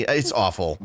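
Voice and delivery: usual speaking voice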